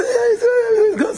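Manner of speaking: squeaky mocking laugh through the words; high laughing mock. Preacher mocking the lucky man with a squeaky laugh.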